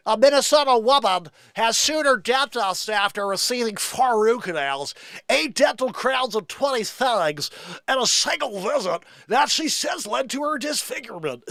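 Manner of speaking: radio announcer read. Shown as a demonstration. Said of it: "I like to do the radio voice".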